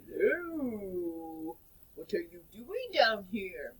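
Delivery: lightly voiced low shark; a hint of a big slow shark greeting. Shark asks the children what they are doing, a lightly voiced low shark. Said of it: "And the shark says".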